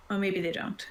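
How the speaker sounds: usual speaking voice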